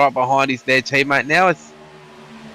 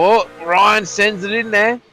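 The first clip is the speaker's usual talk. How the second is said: excited; 7 semitones higher than usual. Real, not acted. Overtake called live.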